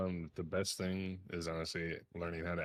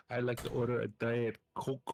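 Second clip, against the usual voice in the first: put-on Spanish accent; 5 semitones higher, 4 dB louder than usual. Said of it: "She's like".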